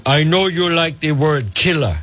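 imitating Lavrov in a thick Russian accent; lightly voiced Russian-accented English; a hint of a Russian diplomat's accent. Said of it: "you'll hear it in his thick Russian accent".

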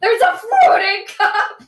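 high, loud laughing exclaim. Real, not acted. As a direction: burst out laughing mid-line. Laughing at floating cup.

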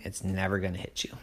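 fast, hushed reassurance; teacher's hushed promise; soft whisper. Acted. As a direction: whisper it gently to calm her.

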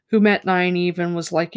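normal talking voice

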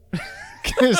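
laughing. Real, not acted. Laughing hard at Derek's word.

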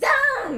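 loud, high whoosh with a laugh; mock slashing sound. Retelling a boy's prank, loud mock swish. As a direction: blurt the ruler swipe noise. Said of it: "みたいなことした".

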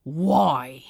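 low, tight words through gritted teeth; through clenched teeth. Acted. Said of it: "teeth clenched".

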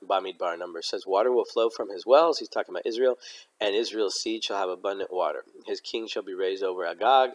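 ordinary talk, nothing marked

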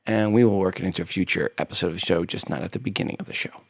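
usual speaking voice

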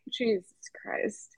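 breathy, exasperated whisper under the breath. Real, not acted. Friend recalling hard times, whispered exasperation. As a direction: hiss it out in disbelief.

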